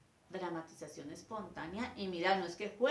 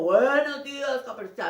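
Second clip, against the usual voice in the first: wolf voice; 5 semitones higher, 15 dB louder than usual. Shown as a demonstration. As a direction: boom it like the wolf. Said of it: "con voz de lobo".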